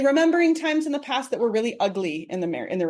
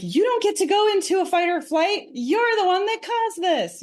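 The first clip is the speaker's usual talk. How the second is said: indignant wife; 11 semitones higher than usual. Retold or imitated. Wife accusing her betraying husband, high and indignant. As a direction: throw his fault back at him.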